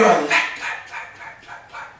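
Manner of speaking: imitated echo trailing into a whisper; fading echo. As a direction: repeat it like a dying show-stage echo. Mimics echoing show catchphrase.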